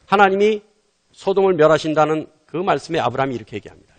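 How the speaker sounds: normal talking voice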